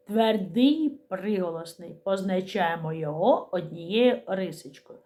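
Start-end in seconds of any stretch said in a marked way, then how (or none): none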